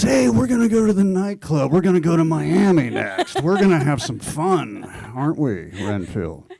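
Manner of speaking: high, loud, playful tempter voice luring a friend out; tempting Dracula. Acted. Dracula coaxing Renfield toward the nightclub, high and loud.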